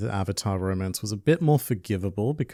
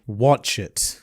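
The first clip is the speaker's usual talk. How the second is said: commanding, vaguely threatening; 4 dB louder than usual. Shown as a demonstration. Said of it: "a really commanding, threatening, vaguely threatening".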